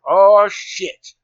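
a startled, dismayed curse, loud and high; dismayed oh shit. Retold or imitated. Hiker told night is coming, dismayed curse. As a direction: blurt it out as if the bad news just landed.

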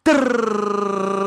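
loud, high rolled trill; long whirring "trrr"; roulette wheel trill. Retold or imitated. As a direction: roll the tongue like a spinning wheel. Imitates the roulette wheel spinning.

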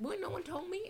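high squeaky complaining voice; high mock whine. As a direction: squeal it in protest. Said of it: "I'm like".

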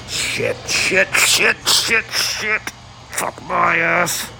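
high, strained shrieks of alarm; panicked high-pitched swearing. Real, not acted. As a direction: squeal it out in sudden panic. Pained reaction, high pitch.

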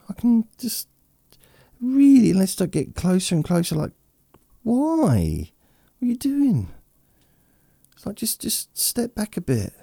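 high, loud exasperated squeak; annoyed at close talker. Retold or imitated. Complaining to a close talker in a high squeak. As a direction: squeal at someone crowding your face. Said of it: "people that talk and they're so close".

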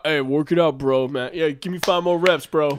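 loud pushy gym bro; frat bro. Retold or imitated. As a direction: bark like a hype spotter. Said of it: "That's how they talk".

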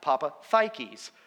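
Papathikis with TH, slow; Greek pronunciation. Shown as a demonstration. Speaker reporting the confirmed surname, slow Greek pronunciation. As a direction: say Papathikis slowly, papa-THIGH-keys. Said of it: "The Greek pronunciation of the last name".

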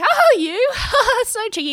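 high, mock-coy exclamation with a laugh; playful brush-off. Comedian jokes off the man's kiss request, playfully high and laughing.

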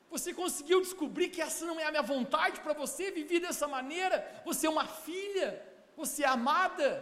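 ordinary talk

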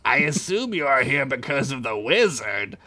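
slow, high mocking spider queen; giant spider lady. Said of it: "The lady began to laugh".

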